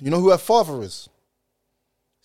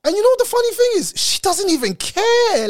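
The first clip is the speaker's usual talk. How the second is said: excited outburst; 5 dB louder than usual. Real, not acted. Worked-up rant.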